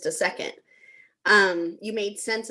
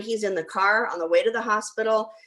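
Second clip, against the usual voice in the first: ordinary talk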